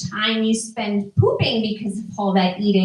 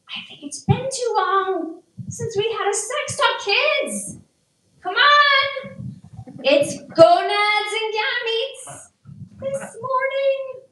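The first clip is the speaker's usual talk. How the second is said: put-on high singsong mom rallying the kids; eager mom; 9 semitones higher than usual. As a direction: call the kids over in a high, lilting voice.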